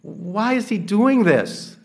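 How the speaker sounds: scandalised host demanding answers; indignant Pharisee. Retold or imitated. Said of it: "the Pharisee is indignant".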